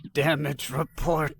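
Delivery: raw, rasping voice; raspy. Acted. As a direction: rasp it out tiredly. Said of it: "Vixel rasped, his voice raw".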